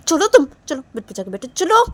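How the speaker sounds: impatient command rising at the end; angry order. Acted. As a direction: order them off with a sharp final push. Sneha orders Neha onto bed. (गुस्से में हुक्म देते हुए)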